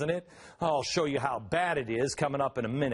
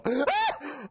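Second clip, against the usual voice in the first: mock horrified gasp; 12 semitones higher than usual. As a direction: gasp in pretend horror. Mock horror at tobacco rule.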